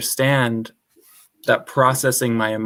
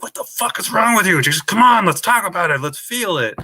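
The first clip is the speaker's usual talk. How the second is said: exasperated plea; 7 semitones higher than usual. Retold or imitated. Daughter to avoidant parents, a frustrated higher-pitched outburst. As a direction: push them to open up, fed up. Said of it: "I'm just like".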